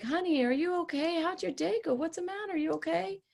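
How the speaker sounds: high, soft, syrupy concern; sugary placating. Shown as a demonstration. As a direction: coo at them to smooth things over. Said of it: "try to nice them into it".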